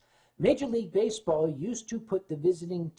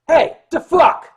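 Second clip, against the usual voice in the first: loud, high, slow New York bark; New Yorker confronting the security chief loudly; New York tough guy; 10 semitones higher, 13 dB louder, slower than usual. Retold or imitated. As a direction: snarl it like a brash New Yorker. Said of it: "New Yorkese".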